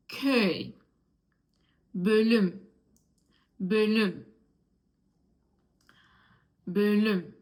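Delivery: ö in köy and bölüm with rounded lips; each word alone and falling, left open for repeating; demonstrated ö sound. Shown as a demonstration. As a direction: model ö clearly in köy, bölüm. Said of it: "Then words which have ö".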